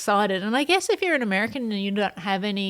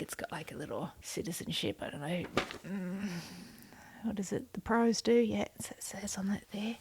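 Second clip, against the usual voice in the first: much softer mumbled reading; muttering; 2 semitones lower, 12 dB quieter than usual. Real, not acted. Reading the tiny flag text, soft mumble. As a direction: mumble to yourself while squinting at it.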